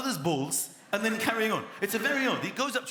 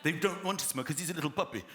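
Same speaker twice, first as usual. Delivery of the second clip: normal talking voice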